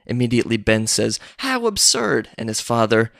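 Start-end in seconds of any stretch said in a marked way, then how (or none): 1.4-2.3 high indignant exclamation; indignant outburst. Retold or imitated. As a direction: scoff it out, offended. Ben rejects accusation.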